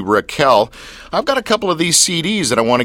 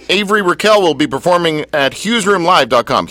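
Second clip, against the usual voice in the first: radio plug; 5 semitones higher than usual. Acted. Plugging the show like an ad.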